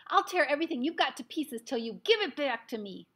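loud, high shout in jerks; a boy shouting while pummeled; jerky shout. A narrator's reading. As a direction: yell it while being shaken. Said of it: "Ami shouted in jerks".

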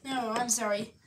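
normal talking voice

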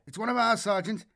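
high, eager radio report; excited young voice over the radio. Acted. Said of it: "Grishin's excited tone".

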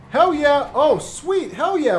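excited game-character voice, starting high; lightly voiced Tyrone. Streamer as Tyrone getting his face back, lightly voiced delight. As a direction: play a delighted cartoonish character.

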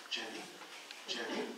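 hushed, calling her name softly; soft whisper to wake her gently. Retold or imitated. Husband whispering to his fainted wife, softly. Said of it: "And so I just start whispering".

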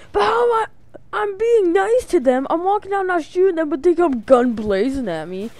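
high, loud, near-crying complaint; frustrated whine. Real, not acted. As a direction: whine it out, exasperated and hurt. Venting at enemies shooting him.

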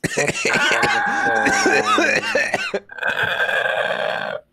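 long, loud, very high cackle; shrieking laughter. Real, not acted. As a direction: explode into sustained squealing laughter. Bursts out at the crude joke.

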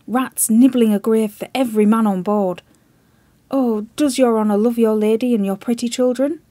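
loud, desperate pleading that keeps climbing; frantic plea. Acted. Chips frantically warning the admiral, loud desperate plea. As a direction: shout the warning, pleading for his life.

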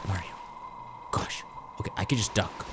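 hushed whisper while sneaking. Real, not acted. Sneaking past an enemy, a hushed whisper. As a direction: keep it under your breath.

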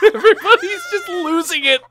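high, loud, helpless laughter through the words; laughing hard. Real, not acted. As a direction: squeal it out while cracking up. Cracking up at the chaos.

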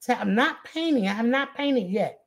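normal talking voice

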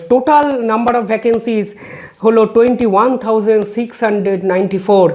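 reading a court order word by word, very slow; slow, measured legal read-out; slow formal reading. Acted. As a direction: spell out each figure deliberately for the record. Reads total from judgment.

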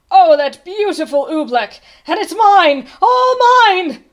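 gloating excited cry. A narrator's reading. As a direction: exult like a greedy, delighted king. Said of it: "he cried".

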